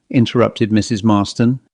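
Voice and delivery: ordinary delivery, nothing marked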